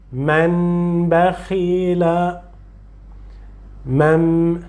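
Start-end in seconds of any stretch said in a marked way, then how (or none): 0.1-2.4 man bakhila drawn out syllable by syllable; very slow recitation. Shown as a demonstration. Teacher repeating man bakhila slowly for the student. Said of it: "Não".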